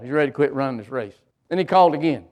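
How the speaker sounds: ordinary delivery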